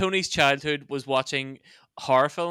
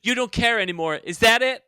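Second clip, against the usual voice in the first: raised, committed American melodrama; dramatic heated fight; 6 semitones higher, 4 dB louder than usual. Acted. Student in a heated fight, dramatic American accent. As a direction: argue it like a hammy soap actor. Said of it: "two students having a heated fight".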